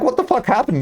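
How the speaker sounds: high incredulous question; baffled. Real, not acted. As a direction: ask in disbelief at yourself. Baffled at own change.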